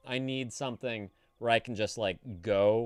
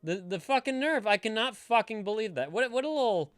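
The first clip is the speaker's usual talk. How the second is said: high, loud outrage; furious high rant; outraged; 9 semitones higher, 4 dB louder than usual. Real, not acted. Streamer fuming at a viewer's husband, high and loud.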